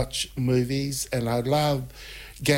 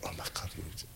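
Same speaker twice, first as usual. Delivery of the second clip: dropped to near silence, lower, breathless thought; hushed inner gasp; 10 semitones lower, 14 dB quieter, much faster than usual. Retold or imitated. Spotting her blind date arriving, hushed and low. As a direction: mutter it to yourself as he walks up.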